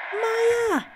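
high urgent shout of the name. Acted. Calling the cat away from danger, high shout. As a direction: call her back in panic.

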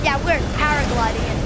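usual speaking voice

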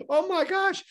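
high laughing disbelief; laugh, then squeal it in delight; giddy amazement. Acted. Streaker spotting a whole movie line of people, giddy amazement. Sees crowd in theater line.

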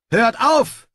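high, loud yell to stop; shouted command. Acted. Snibral stopping two soldiers fighting, loud shout. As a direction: bark it at the brawlers. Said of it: "rief er".

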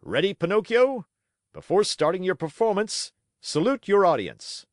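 high, loud showman ordering the donkey; ringmaster's command. A narrator's reading. Manager ordering Pinocchio to salute, loud high ringmaster voice. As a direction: bark it out for the crowd to hear.

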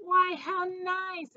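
high, drawn-out delighted mama; gushing storybook mother's welcome; exclaiming. A narrator's reading. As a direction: exclaim it slowly, pitched right up. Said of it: "exclaimed Mama".

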